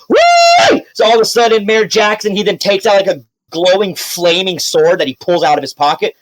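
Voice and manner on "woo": very high loud whoop. Acted. Mayor whooping in excitement, very high. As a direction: let out a wild whoop.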